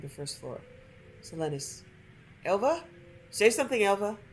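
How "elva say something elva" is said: raised, higher call for Elva. Acted. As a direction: call into the house for an answer. Father calling for missing daughter.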